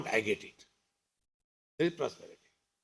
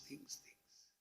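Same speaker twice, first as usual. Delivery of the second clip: whispered trailing repeat; 25 dB quieter than usual. Acted. Trailing list of accumulated things.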